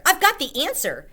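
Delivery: high, loud eager cry; bursting to share the idea; excited announcement. A narrator's reading. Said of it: "I shouted".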